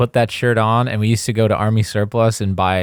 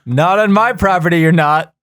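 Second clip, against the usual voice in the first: firm dad; 7 semitones higher, 7 dB louder than usual. Retold or imitated. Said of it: "He goes".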